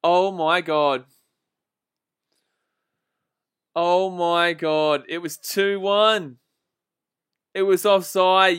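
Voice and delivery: slow, drawn-out oh my god; real slow stunned disbelief. Real, not acted. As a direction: let the shock stretch every word. Disbelief at offside call.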